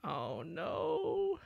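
high, sudden dismay; surprised. Real, not acted. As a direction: caught off guard. Reacts to a summon.

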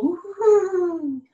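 high hooting wind woo-hoo; wind howl. Acted. Reading to a child, a high howling wind sound. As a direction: hoot like the winter wind.

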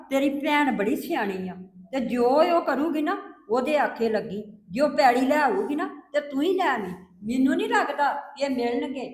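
soft, gentle comforting; consoling. Acted. Mother comforting her crying daughter, soft and tender. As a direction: hush her tears tenderly.

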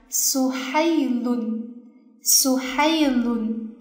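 苏海伦 very slowly, "海" drawn out; stress on middle syllable. Shown as a demonstration. Teacher models the word twice, long stressed "海" in 苏海伦. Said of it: "它的重音就是读在这里".

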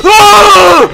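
loud startled shriek; real scream of fright. Real, not acted. Jump scare in horror game.

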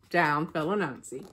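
plain reading voice

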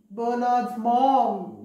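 plain reading voice

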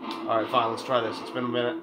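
ordinary talk, nothing marked

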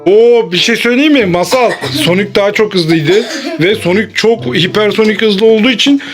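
ordinary talk

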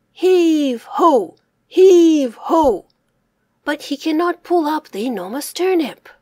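usual speaking voice

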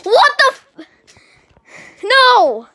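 high, loud cry of disbelief; outraged disbelief. Real, not acted. Player seeing they lost the race, outraged disbelief. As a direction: burst out, stunned at losing.

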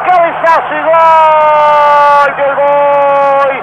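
long stretched goal cry; goal shout. Real, not acted. Goal called on live radio.

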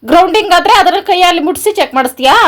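loud, high-pitched accusing question; indignant outcry. Real, not acted. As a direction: confront your husband at full volume. Wife accuses husband of testing on her.

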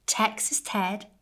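ordinary delivery, nothing marked

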